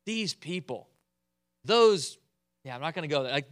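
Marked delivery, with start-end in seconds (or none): 0.0-2.2 a hint of conspiratorial insinuation; lightly hint the people are bad; sly Aaron. Aaron blaming the people, lightly sly. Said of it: "That's what Aaron says".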